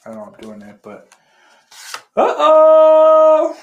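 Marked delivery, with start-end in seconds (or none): 2.2-3.6 high, loud put-on cartoon voice. Host fumbles on camera, cartoon uh-oh much higher and louder. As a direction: squeal it like a cartoon. Silly exclaim after a fumble.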